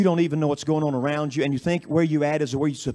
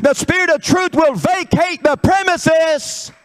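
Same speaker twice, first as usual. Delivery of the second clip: high, loud, driving revival preacher; full-force preaching; 11 semitones higher, 6 dB louder than usual. Real, not acted. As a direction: build to a drawn-out, shouted warning.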